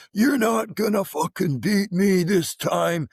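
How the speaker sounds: high, loud, slow yell of defiance; retold yell. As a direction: yell it at the machine like a fight. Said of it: "he's yelling at the treadmill".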